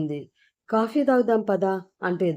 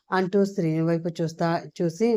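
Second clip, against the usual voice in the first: ordinary delivery, nothing marked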